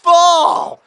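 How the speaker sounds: high, loud cry of despair at a miss; anguished shout. Real, not acted. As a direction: howl at the player who fell. Missed goal, shouting at player.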